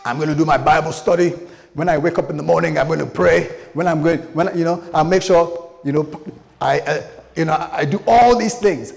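hammed-up eager believer; mock zeal. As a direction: rattle off pious resolutions with overblown eagerness. Mock zealous Bible-study resolution.

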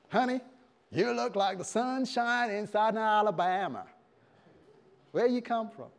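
high-pitched Southern American flirting; excited Southern American sweet talk; Southern American suitor. Acted. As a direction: woo her in a hammed-up Southern American accent. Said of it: "Southern American".

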